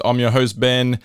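normal talking voice

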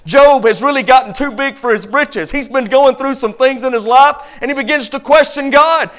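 ordinary talk, nothing marked

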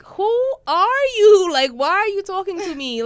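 very loud, high, stretched-out challenge; incredulous outrage. Retold or imitated. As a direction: belt it out high, dragging the first question. Indignant at intrusive passenger.

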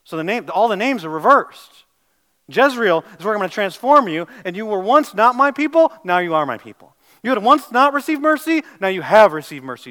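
loud, driving sermon voice; full-force preaching. Acted. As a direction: hammer the point home to the congregation.

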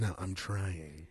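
low, soft creepy voice. Pretend. Showing off his new voices, low creepy murmur. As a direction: murmur it like a serial killer. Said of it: "That's the serial killer voice".

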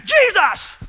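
high, loud exasperated shout; exasperated outburst. Retold or imitated. Host overwhelmed by holiday cleaning, shouting in exasperation. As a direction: blurt it out at the end of your rope. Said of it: "You've had that moment".

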